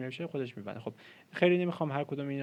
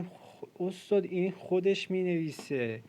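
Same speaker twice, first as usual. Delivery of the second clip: amazed student; 6 semitones higher, 4 dB louder, slower than usual. Retold or imitated. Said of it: "گفتم".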